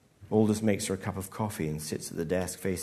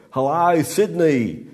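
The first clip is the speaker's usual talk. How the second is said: loud, high Australian greeting; a big brash Aussie hello to the crowd; Australian accent; 7 semitones higher, 10 dB louder, slower than usual. Acted. Comic greeting the drunken audience, loud Australian accent. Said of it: "in a good Australian accent".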